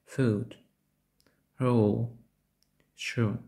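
"Food", "Roll", "Shoe" with a long Ukrainian-like U; довгий У. Shown as a demonstration. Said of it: "Нагадує український довгий голосний У". (довгий голосний У в "Food", "Roll", "Shoe")

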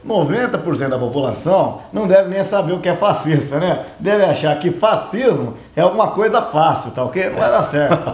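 ordinary talk

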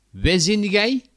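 high, loud Brabant dialect question; Brabant accent. Acted. Brabander asking a question, loud Brabant accent. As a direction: call it out in broad Brabants. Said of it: "Een Brabander".

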